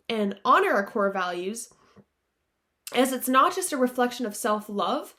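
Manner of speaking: ordinary delivery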